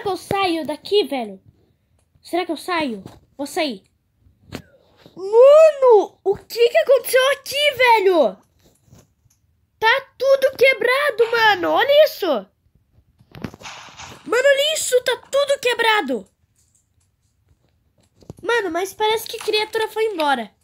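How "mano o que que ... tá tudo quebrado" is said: high, loud shocked disbelief; shocked cry at the wrecked room; scared horror game streamer. Real, not acted. Finds wrecked base, creature gone.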